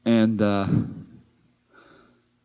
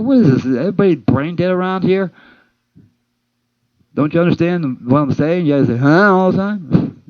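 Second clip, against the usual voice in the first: mock outrage; 6 semitones higher than usual. Narrator to confused coworker, exasperated outburst. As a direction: throw up your hands at the coworker.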